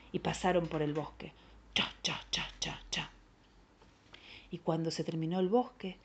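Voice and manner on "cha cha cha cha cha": soft, rhythmic cha-cha steps; hushed step noises, evenly spaced; whispered forest footsteps. Acted. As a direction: whisper each step, short and even. Imitates steps through forest.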